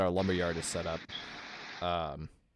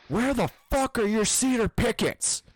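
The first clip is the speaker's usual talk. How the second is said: high, loud imitation of an angry old man; imitating angry driver; 14 semitones higher, 4 dB louder than usual. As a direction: mimic a furious customer ranting.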